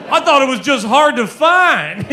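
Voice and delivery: high, loud mock outburst; exclaimed comic retort. Skinny guy alone at a burger bar answering a lady, loud mock outburst. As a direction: blurt it out loudly, pitch jumping up. Acted retort to server in bit.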